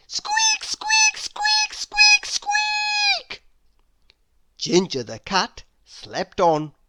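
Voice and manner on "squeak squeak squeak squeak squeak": very high squeaks at full force; loudest mouse squeak. A narrator's reading. Mouse squeaking at the sleeping cat. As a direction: squeak as loud as a mouse can. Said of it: "squeaked at the top of his squeak".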